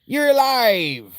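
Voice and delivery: high, loud, slow cry; slow, high and loud character cry; character impression. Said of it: "That's what she sounds like".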